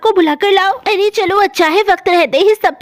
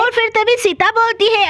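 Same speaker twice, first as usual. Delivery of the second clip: usual speaking voice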